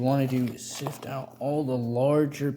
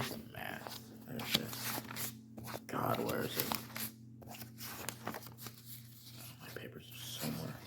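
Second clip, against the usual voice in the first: whispering; 8 semitones lower, 12 dB quieter than usual. Acted. Searching frantically for lost papers.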